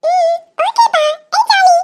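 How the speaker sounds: put-on high little girl, slow okay then a cheer; a small kid delighted about the trip; happy child voice. Daughter cheers about the outing, high child voice.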